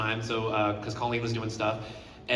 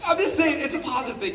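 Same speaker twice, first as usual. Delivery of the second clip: choked-up crying; 14 semitones higher, 6 dB louder than usual. Real, not acted. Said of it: "Don't be crying".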